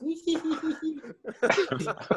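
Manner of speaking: high-pitched giggly raptor cackle; quick, high mock-creature titter; raptor noise. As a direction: squeal like a cheeky little raptor. Imitates little raptor harassing big players.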